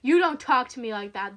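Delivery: loud, menacing rebuke; angry bully's warning; hissed anger. Acted. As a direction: put the listener in their place forcefully. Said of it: "the man hissed".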